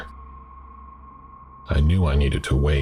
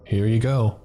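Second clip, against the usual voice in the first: higher, chatty diner waitress voice; friendly waitress; 7 semitones higher than usual. Acted. Diner waitress to shaken customer, higher and chatty. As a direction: play the waitress offering free refills.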